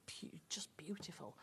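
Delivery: barely voiced, admiring; hushed whisper. Real, not acted. Host admiring the green wool, whispered in awe. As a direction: breathe it out in an awed whisper.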